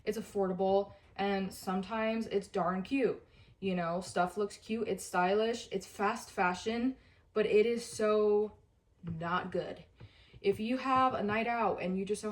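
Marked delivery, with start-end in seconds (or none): none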